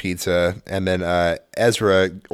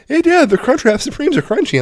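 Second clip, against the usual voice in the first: mock kid voice; 4 dB louder than usual. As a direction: mimic the son, proud of his discovery. Mocking son's announcement.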